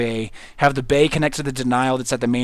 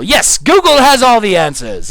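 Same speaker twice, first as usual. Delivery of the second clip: loud, high, drawn-out declaration; mock-grand proclamation; 7 semitones higher, 8 dB louder, slower than usual. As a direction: announce it with exaggerated zeal. Mock-grand proclamation about Google.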